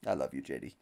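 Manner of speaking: tender coo. Real, not acted. Cooing to the dog.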